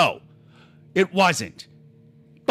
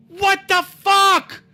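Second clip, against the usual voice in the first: exasperated; 10 semitones higher, 5 dB louder, much slower than usual. Retold or imitated. Retold reaction to mother.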